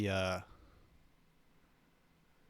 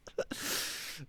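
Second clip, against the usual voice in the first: soft laugh; 9 dB quieter than usual. Real, not acted. Laughing at own joke.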